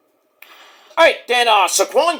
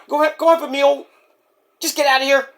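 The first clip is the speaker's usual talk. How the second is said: higher put-on voice telling them off; put-on voice shooing; 8 semitones higher, faster than usual. Skit character shooing the other out, higher voice. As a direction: shoo them away in character.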